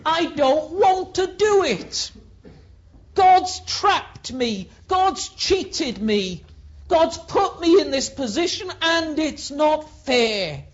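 loud, high, aggrieved protest; Jeremiah complaining. Acted. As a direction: push back, it's unfair. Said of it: "he says".

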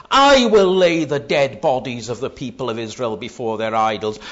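each "I" hit high and loud, then falling; loud preaching, "I" punched at each start; string of emphatic I's. Retold or imitated. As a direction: thunder out every "I" as God's threat. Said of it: "a whole string of I's of the Lord".

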